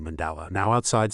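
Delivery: plain reading voice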